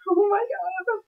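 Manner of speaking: very high, thin gasp of amazement; real, high-pitched disbelief at first sight; overwhelmed awe. Real, not acted. First sight of the fursuit head.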